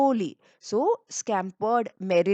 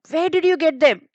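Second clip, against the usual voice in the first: high, loud and sulky; sulky angry question; 7 semitones higher, 7 dB louder than usual. A narrator's reading. Jealous sister demanding where the flowers came from, sulky and loud. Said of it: "Helena sulkily, sulkily means in a very, uh, angry way".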